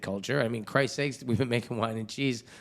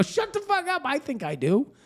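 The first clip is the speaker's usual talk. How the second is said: very high-pitched indignant retort; mock-outraged comeback. Parent answering the son's jab, mock outrage. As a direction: fire back in exaggerated outrage.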